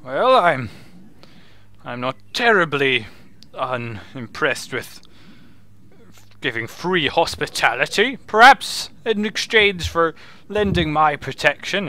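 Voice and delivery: a hint of a haggling wizard, lilting; lightly voiced wizard. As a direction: lightly play a wizard bargaining over hospitality. Game master plays wizard.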